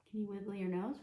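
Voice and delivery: ordinary delivery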